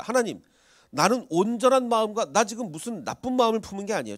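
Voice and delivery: Abimelech protesting loudly to God. Retold or imitated. Abimelech protests loudly to God. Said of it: "하나님한테 항변을 합니다".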